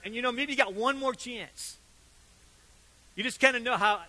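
ordinary talk

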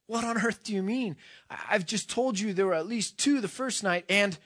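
put-on high-pitched, protesting boy; indignant disbelief. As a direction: object in disbelief, pitch jumping up.

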